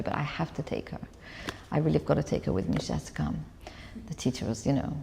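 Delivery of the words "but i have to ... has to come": hushed father. Retold or imitated. Father quietly taking her from class.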